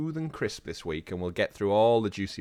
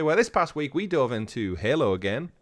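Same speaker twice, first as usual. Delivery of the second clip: ordinary delivery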